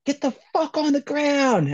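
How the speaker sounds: high, drawn-out mock shout of a cop; imitated police yell. Retelling police ambushing kids at the dam, mock yelling. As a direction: holler it like a raiding officer, without full volume. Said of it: "and we just hear".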